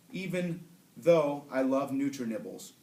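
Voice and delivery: ordinary delivery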